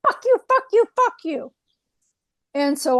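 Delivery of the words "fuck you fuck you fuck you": high, loud imitated cursing spirit; mock yelled curses from an unseen spirit. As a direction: spit the curses out high like an angry little ghost. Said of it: "and I hear".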